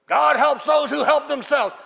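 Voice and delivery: high, loud mock preachiness; hammed-up yelled slogan; shouted maxim. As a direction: belt out the saying as an objector. Loud objector quoting a maxim.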